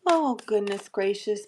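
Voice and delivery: ordinary delivery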